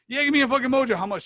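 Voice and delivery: high, loud, keen shout across a bar; eager ordering. Retold or imitated.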